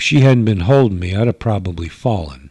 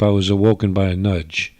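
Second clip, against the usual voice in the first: plain reading voice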